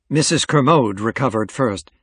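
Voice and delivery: ordinary delivery, nothing marked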